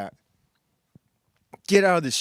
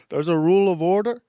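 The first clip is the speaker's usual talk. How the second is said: louder, quick, badly faked European accent; 3 semitones higher, 6 dB louder, faster than usual. Host mocking complaining Europeans, clumsy European accent. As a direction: attempt a European accent and miss. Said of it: "I can't do a European accent".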